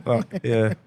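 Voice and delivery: normal talking voice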